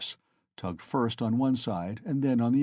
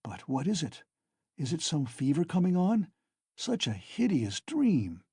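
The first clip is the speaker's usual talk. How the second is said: shaken and fearful; 5 semitones higher than usual. A narrator's reading. Waking from nightmare, shaken.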